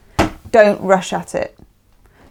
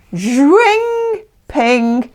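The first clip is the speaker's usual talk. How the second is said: a much higher zwing sliding down to ping; playful zwing noise; 6 semitones higher than usual. Retold or imitated. Reader mimicking a quick relationship, with playful sound effects. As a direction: sound effect of things snapping into place. Imitating things clicking into place.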